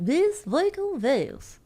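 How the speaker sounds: "This vocal voice" drawn out very slowly with swooping high pitch; exaggerated odd pronunciation. Shown as a demonstration. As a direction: stretch every vowel of "This vocal voice" in mock affectation. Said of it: "I don't know why I say it like that".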